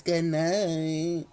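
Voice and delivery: low, smooth mock radio voice; sexy radio voice. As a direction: tease with a sultry DJ voice. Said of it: "the, the sexy voice".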